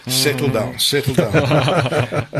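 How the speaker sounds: real laughter breaking into the words; laughing. Real, not acted. Guest calming the host's eager anticipation, laughing. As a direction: chuckle through it, stretching out the last words.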